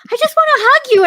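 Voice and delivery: Buddy gushing at a raccoon; childlike gush. Retold or imitated. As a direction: squeal it like an overjoyed kid. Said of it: "he's like".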